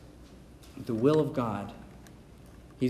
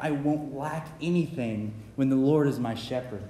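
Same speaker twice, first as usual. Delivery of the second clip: normal talking voice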